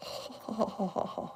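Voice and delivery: soft laugh under the breath; whispered giggle. Real, not acted. Reacting to a cute goat picture.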